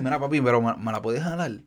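low, soft imitation of a creep; lightly voiced creep asking the father for a favor; mock creepy request. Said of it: "y dice".

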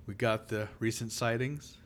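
soft, hushed scene setting; lightly hushed storyteller voice; hushed creepy legend telling. Acted. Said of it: "You're setting the scene".

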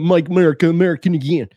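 mock American accent. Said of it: "I don't know why I chose that accent".

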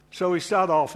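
ordinary talk, nothing marked

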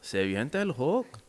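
imitated Mexican Spanish speaker, lilting up at the end; hammed-up Mexican Spanish accent. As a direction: put on a Mexican accent, playfully. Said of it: "Mexicans with accents say Hulk".

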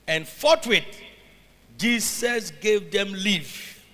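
loud, very slow, weighty; preaching at full force. Acted. Preacher proclaims the verse to the congregation, loud and slow. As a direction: lean hard on every word. Emphatic slow scripture reading.

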